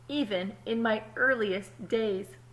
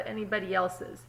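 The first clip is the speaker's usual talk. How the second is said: usual speaking voice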